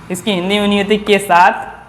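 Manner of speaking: ordinary talk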